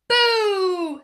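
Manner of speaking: high loud boo; shouted boo. Acted. Cub's final boo at the odd-looking man, loud. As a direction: jump out and yell.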